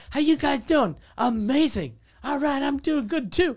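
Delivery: high-pitched canned crowd greeting; mock a green comic's stock opener; imitated hacky stand-up patter; bad new comic. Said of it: "like, a new shitty comic, he's like".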